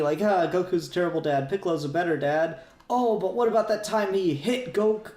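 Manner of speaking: usual speaking voice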